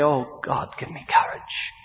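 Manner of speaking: hushed, breathy little prayer; whispered plea. Acted. Believer praying before witnessing, whispered plea.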